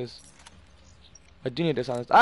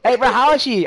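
higher, louder imitation of the friend; mock friend voice; 8 semitones higher, 7 dB louder than usual. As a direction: pester like the friend, lightly. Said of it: "He's like".

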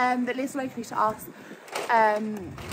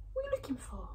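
higher, gentle pet talk; 4 semitones higher, 12 dB quieter, much faster than usual. Real, not acted. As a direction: coo it to the pet. Asking the pet again.

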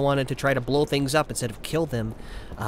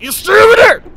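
loud, high yelled warning; panicked shout; 12 dB louder than usual. Real, not acted. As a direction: yell to keep the enemy back. Warning repeated as enemy nears.

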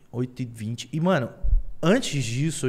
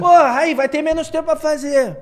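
impatient; 10 semitones higher, 6 dB louder than usual. Retold or imitated. Complains teacher is late.

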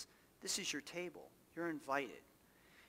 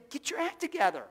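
mock scolding; 9 semitones higher, 6 dB louder than usual. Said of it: "we say".

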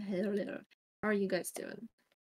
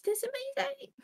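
squealed high "amazing"; delighted; 3 dB louder than usual. Real, not acted. Streamer thrilled at Raven's three-month resub, squealing high. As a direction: shriek with joy at the sub.

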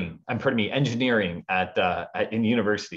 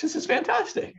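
mock excited. Retold delight at being paid.

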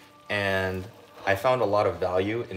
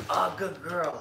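happy dog praise; 7 semitones higher than usual. Real, not acted.

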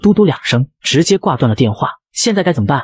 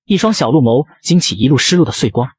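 ordinary delivery, nothing marked

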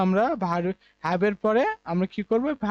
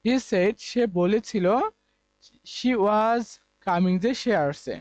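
slow example; much slower than usual. Shown as a demonstration. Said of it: "এবার পাস্টে রয়েছে".

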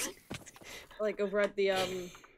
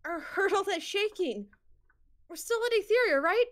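high, worried Hecate voice; 11 semitones higher than usual. Acted. Hecate asking the crew about the city, high and worried. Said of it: "Can't do Hecate justice right now".

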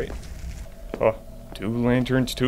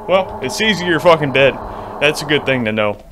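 ordinary talk

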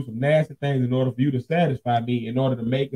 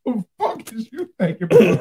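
higher pitched, laughing through it; laughing quote; 6 semitones higher than usual. Retold or imitated. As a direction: quote him while cracking up. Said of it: "he was looking at me like".

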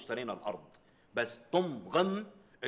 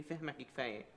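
quiet coy wife; 7 dB quieter than usual. Retold or imitated. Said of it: "بتتغماني وبتقول لي".

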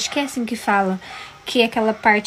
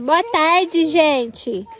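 high sing-song greeting; child prompt; 6 semitones higher, 4 dB louder, much slower than usual. Shown as a demonstration. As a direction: coax a toddler to say it.

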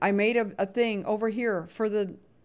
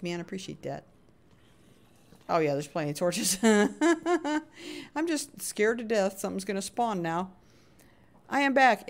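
usual speaking voice

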